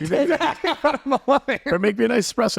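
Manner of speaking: laughing while calling out. Real, not acted. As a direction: call out mid-laugh. Playful order, laughing.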